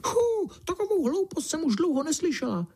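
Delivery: very high squeaky little mouse voice; exclaiming field mouse. A narrator's reading. Mouse scoffs at foolish talk she heard, high-pitched exclamation. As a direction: pipe up high, far from your own voice. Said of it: "vykřikla rezavá polní myška".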